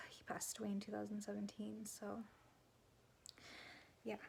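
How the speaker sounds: soft, breathy whisper after a sigh; real grief, whispered; holding back tears. Real, not acted. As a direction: barely get the words out, fighting emotion. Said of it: "Hold on".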